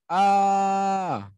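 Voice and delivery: high short shouted ah; demo shout. Shown as a demonstration. Teacher shouting to demonstrate an echo, high shout. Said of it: "Jadi kalau kita teriak".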